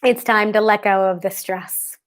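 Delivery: calm, steady affirmation voice; guided tapping. Acted. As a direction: recite each tapping point soothingly. Leads tapping script aloud.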